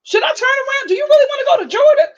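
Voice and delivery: as the driver, asking the woman if she wants to turn back, alarmed and fast. Pretend. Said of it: "I'd be like".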